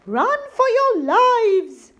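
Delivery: high, loud, slow panicked cry; loud slow panic shout. Acted. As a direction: yell to everyone to flee. Robbers flee in panic.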